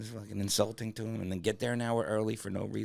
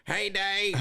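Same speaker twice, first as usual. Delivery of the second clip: high, loud hillbilly dog voice; 13 semitones higher, 6 dB louder than usual. Acted. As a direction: call out as a backwoods hound. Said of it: "Now your dog talk like this".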